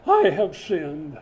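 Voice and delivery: slow, drawn-out televangelist confession; Jimmy Swaggart voice. Acted. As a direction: wail it like a penitent TV evangelist. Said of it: "in my best Jimmy Swaggart voice".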